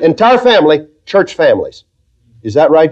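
ordinary delivery

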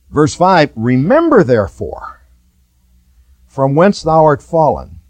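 loud, high, fervent preaching to the congregation; full-force preaching. Acted. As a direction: preach it out with fire.

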